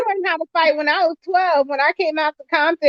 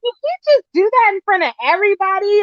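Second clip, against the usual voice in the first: high, fast shocked disbelief; retold shock; 6 semitones higher, faster than usual. Telling the host about the school fight, high shocked disbelief. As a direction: gasp it out, still can't believe it. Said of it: "I was in shock".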